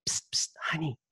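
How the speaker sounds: hushed whisper nudging her husband; wife nudging her husband in the office, secret whisper. Retold or imitated. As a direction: whisper it so the man can't hear.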